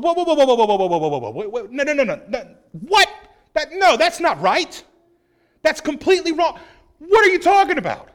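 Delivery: panicked disciples shouting no; alarmed protest. Retold or imitated. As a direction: disciples balk at Jesus in rising alarm.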